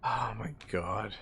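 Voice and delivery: breathless whisper, slowed; whispered disbelief. Real, not acted. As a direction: whisper it in stunned dismay. Stunned by civil war news.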